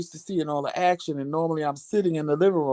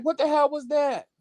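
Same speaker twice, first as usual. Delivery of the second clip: high-pitched replay of being startled; retold alarm; 10 semitones higher than usual. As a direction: re-enact your startled question. Retells own fright to partner.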